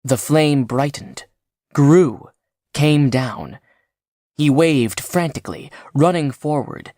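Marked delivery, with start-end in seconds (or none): none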